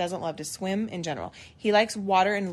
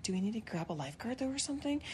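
hushed question; 13 dB quieter, faster than usual. Retold or imitated. Moms asking quietly.

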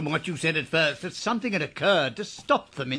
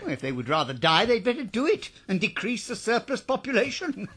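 normal talking voice

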